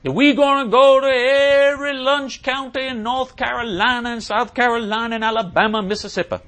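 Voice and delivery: high, loud, stretched-out oratory; hammed-up mock civil rights preacher; Martin Luther King. Host imitates a speech given in a church, mock rousing orator. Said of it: "he'd give his speech and he'd say".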